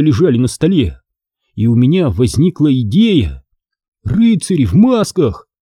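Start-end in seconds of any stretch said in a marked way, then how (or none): none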